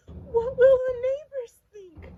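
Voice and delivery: very high, loud fretting cry; worried mother. Acted. Mother fretting about the neighbors. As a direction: panic like a fussy mom. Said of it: "worried his mother".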